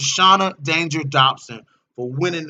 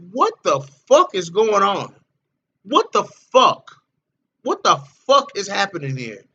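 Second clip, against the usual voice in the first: a hint of stunned disbelief, stretching the middle "What the fuck"; lightly acted, staring at the fans in shock; mock disbelief; 5 semitones higher, 3 dB louder than usual. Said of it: "And I'm looking at y'all like".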